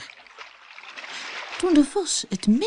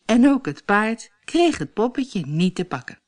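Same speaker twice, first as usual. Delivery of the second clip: plain reading voice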